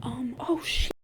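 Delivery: soft unvoiced oh shit; whispered dismay. Real, not acted. Realizing Grace betrays Freddie, whispered to viewers. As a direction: breathe it out under your breath.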